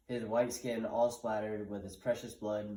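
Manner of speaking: ordinary delivery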